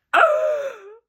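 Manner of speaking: loud, high-pitched gasp; shocked gasp. Real, not acted. Reader hit by the cold Nasta line, shocked gasp. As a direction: suck in a huge shocked gasp.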